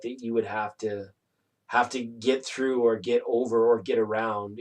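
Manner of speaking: normal talking voice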